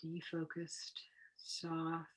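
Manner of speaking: whispered meditation cues; hushed, almost silent guidance. Acted. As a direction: breathe the words out as a whisper.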